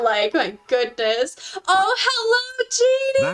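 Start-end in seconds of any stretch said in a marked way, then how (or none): 1.7-3.3 high, drawn-out squeal of welcome; delighted greeting. Real, not acted. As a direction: greet a much-missed friend, high and slow. Greeting a returning viewer.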